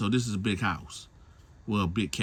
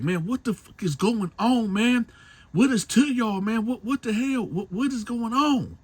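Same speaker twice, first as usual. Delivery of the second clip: much higher, frantic questions; panicked; 13 semitones higher, 4 dB louder than usual. Retold or imitated. Man in the cabin shouts high at the crowd in panic. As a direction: voice shooting up in retold panic.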